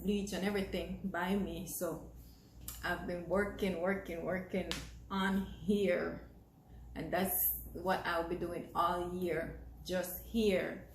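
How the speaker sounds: ordinary delivery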